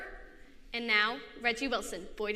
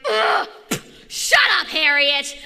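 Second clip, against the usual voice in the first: loud, higher, fed-up shout at a rival; exasperated kid yelling shut up; annoyed yell; 5 semitones higher, 15 dB louder, slower than usual. Acted. Reggie snaps at rival.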